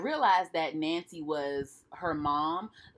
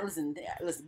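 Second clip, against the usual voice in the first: ordinary talk, nothing marked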